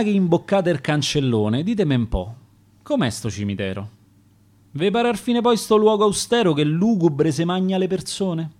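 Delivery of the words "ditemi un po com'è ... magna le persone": plain reading voice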